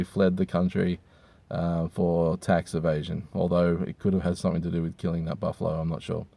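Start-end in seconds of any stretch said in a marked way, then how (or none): none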